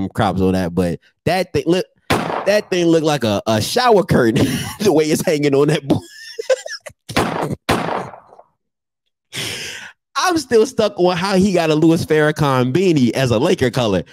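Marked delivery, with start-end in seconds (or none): 6.4-8.1 high breathy laugh. Real, not acted. Laughing at photo.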